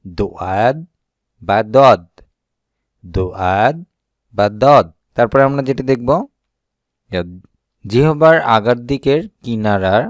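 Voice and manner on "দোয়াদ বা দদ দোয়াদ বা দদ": দদ said louder, from the side of the tongue against the upper teeth; letter demo. Shown as a demonstration. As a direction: press out দোয়াদ, then a loud দদ. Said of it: "জিহ্বার গোড়ার দিকের পার্শ্ব উপরের দাঁতের সাথে মিশিয়ে উচ্চারণ".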